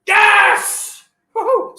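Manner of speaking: loud, high whoop of victory; real elated cheering; jubilant yell. Real, not acted. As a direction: let out a triumphant yes. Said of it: "my wife's telling me to shut up".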